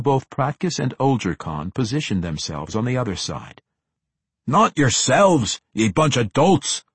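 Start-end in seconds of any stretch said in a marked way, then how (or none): 4.5-6.8 loud, slow gruff dwarf; grumbling dwarf. A narrator's reading. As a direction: grumble it at the dolts. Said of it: "the dwarf grumbled".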